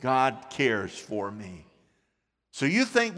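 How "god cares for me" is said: slow affirmation. Shown as a demonstration. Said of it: "Say that with me".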